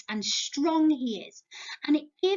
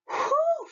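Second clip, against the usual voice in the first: whew; 14 semitones higher than usual. Shown as a demonstration. Reacting to hot sunny weather.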